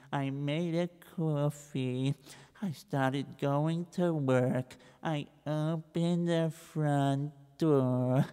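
soft, slow, dragging account; slow plodding recital. Acted. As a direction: drag out every boring detail. Reb Israel plods again.

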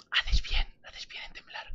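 breathy menacing whisper right in the ear. Acted. Taunting the trembling boss, whispered threat in its ear. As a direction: whisper it like a threat at close range. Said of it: "iba a decirte así en el oído".

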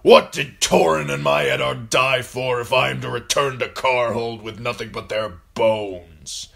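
aggrieved northern lord's challenge; bereaved lord. A narrator's reading. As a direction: demand an answer for your dead. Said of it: "asked Rickard Karstark".

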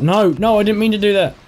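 high, loud panicked protest. Real, not acted. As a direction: blurt it out in sudden panic. Accidental action, panic.

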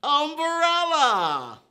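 high, loud, slow "Umbrella!" with a laugh; excited reveal. Acted. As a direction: announce it with glee.

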